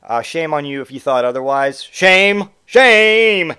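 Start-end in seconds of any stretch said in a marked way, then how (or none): 1.8-2.5 shouted scolding. Acted. Scolding the listener mock-sternly. 2.7-3.6 shouted scolding. Acted. Repeated louder, mock scolding.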